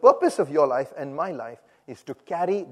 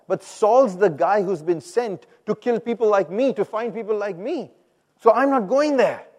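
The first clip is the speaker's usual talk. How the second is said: alarmed pleading, rising louder and higher; acted worried objection to God; frightened protest; 4 semitones higher, 6 dB louder than usual. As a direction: protest anxiously, building to a loud refusal. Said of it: "I think he's frightened".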